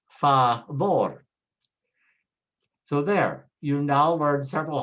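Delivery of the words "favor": favor as fa-VOR, loud on VOR; emphatic fa-VOR for favor; Spanish last-syllable stress. Shown as a demonstration. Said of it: "stress on the last syllable".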